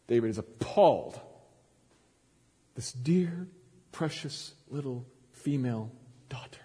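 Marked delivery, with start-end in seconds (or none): 2.8-6.6 breathy whisper of horror; hushed whisper. Acted. Preacher whispering David's shock at the crime.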